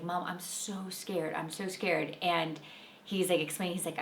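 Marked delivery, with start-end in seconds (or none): 0.0-2.2 soft, slow imitation of a worried little boy; scared child. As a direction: play the son's bedtime worry, hushed and slow.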